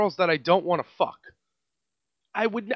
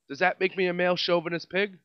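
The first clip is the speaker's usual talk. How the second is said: normal talking voice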